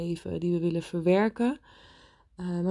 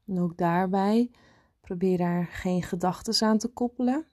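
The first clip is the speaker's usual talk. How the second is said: guided meditation. Acted. Leads a meditation.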